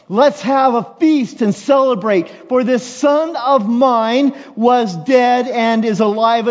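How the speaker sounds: high, loud call to the servants; joyful shout. Acted. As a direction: shout it out as a rejoicing father. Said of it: "you hear the father shout".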